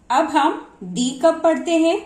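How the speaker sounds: ordinary delivery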